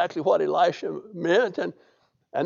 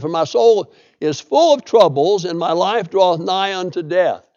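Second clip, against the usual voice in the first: louder, measured Bible reading; scripture reading; 5 dB louder than usual. Acted. Preacher reading the psalm to the congregation, solemnly louder. Reads psalm verses aloud.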